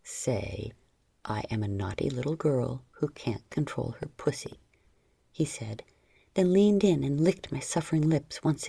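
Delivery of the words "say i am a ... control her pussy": deep, quiet instruction; low hushed male command; low soft command. A narrator's reading. Lover dictates words.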